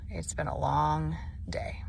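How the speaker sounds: whispered, drained, to the camera; tired whisper. Real, not acted. Worn out after moving day, whispering to viewers. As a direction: let the long day show, barely voiced.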